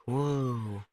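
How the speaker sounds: soft, drawn-out whoa; hushed amazement; quietly wowed; awed dog. Pretend.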